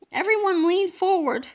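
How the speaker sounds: ordinary delivery, nothing marked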